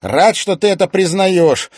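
ordinary delivery, nothing marked

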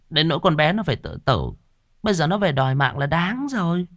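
tut-tut about the family's sins; gossiping woman. A narrator's reading.

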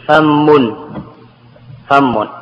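فم with a held doubled m; slow, press the doubled m in فم. Shown as a demonstration. To students, demonstrating the doubled form فم. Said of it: "والتشديد".

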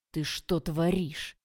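slow, loud, dumbfounded question; stunned. A narrator's reading. Oleg sees her kick the car, stunned slow exclamation. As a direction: stretch every word out in disbelief. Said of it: "ошалело спросил Олег".

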